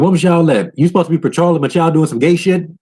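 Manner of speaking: high, sneering voice of a soldier teasing; a soldier's high teasing jab; mock taunt. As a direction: pitch it up to taunt the patrol. Mocking a character's taunt.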